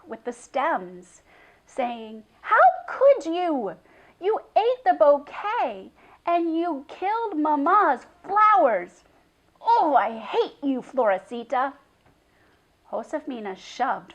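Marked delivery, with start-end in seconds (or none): 2.4-9.0 loud, high, bitter accusation; angry tirade. A narrator's reading. Girl swats the goat, raging. 9.6-11.7 angry cry. A narrator's reading. First angry cry at goat.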